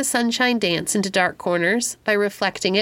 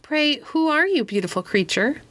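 higher-pitched opening, touch of wonder; mild exclamation; 7 semitones higher than usual. A narrator's reading. Pandora questions the beautiful winged stranger, mildly exclaiming. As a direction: start high in wonder, then settle. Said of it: "exclaimed Pandora".

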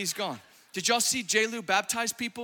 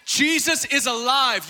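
slow, louder triumphant declaration; bold proclamation; 3 semitones higher, 5 dB louder than usual. Retold or imitated. Bold mom at work declaring faith, loud slow proclaim. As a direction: proclaim it boldly.

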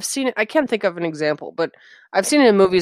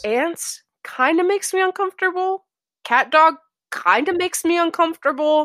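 much higher, squeaky-uncomfortable voice; pitched way up, comically uneasy; high-pitched unease; 11 semitones higher than usual. Real, not acted. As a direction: confess it in a high, strained pitch. Joking confession in high voice.

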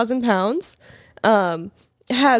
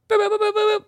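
high excited boop boop noises; 11 semitones higher, faster than usual. Acted. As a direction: bleat it like a happy horn. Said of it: "it was my excited noise".

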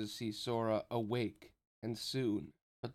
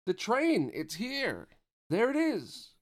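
excited shout; 11 semitones higher, 6 dB louder, slower than usual. A narrator's reading. Roxas shouts at ghost train.

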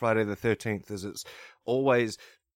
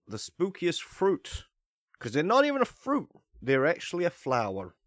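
usual speaking voice

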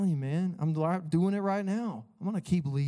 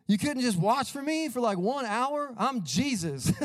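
high, exasperated bro-speak; Jesus scolding his friends like a buddy; mock-annoyed Jesus; casual Jesus voice; 9 semitones higher than usual. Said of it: "is my translation".

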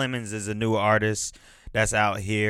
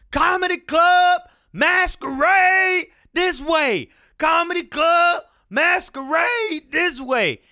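high, drawn-out street hawker's cry; imitation of a corner crier's shout; imitated screaming; 3 dB louder, slower than usual. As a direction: holler it out high, mimicking a man calling to passersby. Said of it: "in the corner just screaming".

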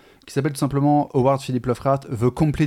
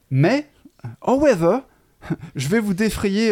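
amused build; 8 semitones higher than usual. Retold or imitated. Punchline of the prank note.

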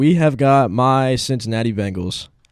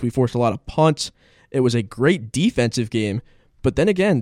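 ordinary talk

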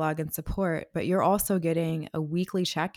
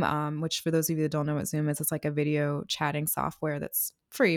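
usual speaking voice

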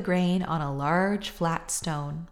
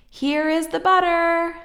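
excited shout; 11 semitones higher, 7 dB louder than usual. A narrator's reading. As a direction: call out proudly with the egg. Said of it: "shouted Jack".

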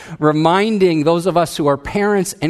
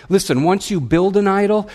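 usual speaking voice

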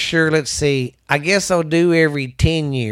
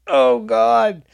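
stretching groan; 8 semitones higher than usual. Real, not acted. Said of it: "I was stretching".

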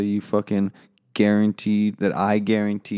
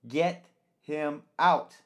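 drawn out, much slower, high and pointed; slow angry demand; 7 semitones higher, much slower than usual. Acted. Host repeating the order to the staff, slow and pointed. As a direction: stretch each word out in anger.

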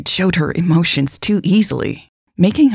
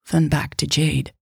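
plain reading voice

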